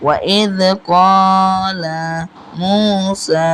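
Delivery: very slow recitation, long vowels in "قال" and "موسى"; "موسى" chanted with both vowels drawn out; one-alif elongation. Shown as a demonstration. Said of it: "এক আলিফ পরিমাণ টান দিতে হবে".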